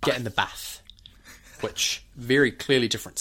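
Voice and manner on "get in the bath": Yorkshire demo, BATH with a short 'a'; Yorkshire accent. Shown as a demonstration. Teacher giving an example phrase, Yorkshire accent. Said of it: "Whereas if in a Yorkshire accent".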